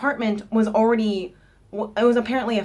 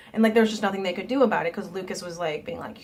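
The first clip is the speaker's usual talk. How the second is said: ordinary delivery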